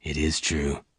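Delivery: low, soft man's voice; gentle confirmation. A narrator's reading. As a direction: confirm it quietly and deep. Lassiter softly confirms to Bess.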